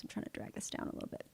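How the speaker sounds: barely voiced, quick aside to oneself; muttered aside. Real, not acted. Instructor to herself while dragging the panel down, hushed muttered aside. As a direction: murmur it quickly under your breath.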